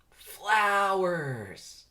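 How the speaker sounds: high, loud delighted shout; thrilled exclamation; excited cry. Acted. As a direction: call out in wonder. Barry spots flowers.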